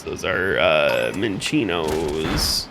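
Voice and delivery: very low, slow, dragged-out voice. Pretend. Sudden deep joke voice.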